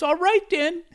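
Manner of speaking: high squeaky shrug; cheerful high stooge voice; Curly voice. Retold or imitated. Voicing Belushi doing Curly.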